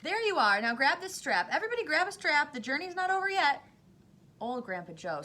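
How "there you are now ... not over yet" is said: high, loud, quick orders; rally everyone to hold on; urgent call. A narrator's reading. Wonka ordering everybody to grab a strap, urgent call.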